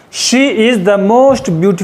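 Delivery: "most" stretched and stressed, whole line very slow. Shown as a demonstration. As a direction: spell out each word slowly, lean on "most". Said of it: "का most कर देंगे". ("most" पर ज़ोर, बहुत धीरे बोलें)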